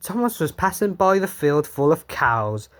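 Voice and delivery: usual speaking voice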